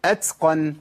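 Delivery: أتقن said as "atsqan"; wrong seen after ta. Shown as a demonstration. Teacher showing a forbidden way, ت followed by س. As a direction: add a hissed س after the ت of أتقن. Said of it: "أجيب سين بعد التاء".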